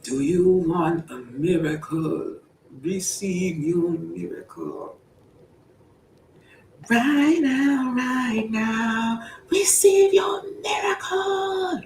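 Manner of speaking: hammed-up preacher calling out miracles; mock preaching. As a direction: preach it like a TV faith healer, building to a shout.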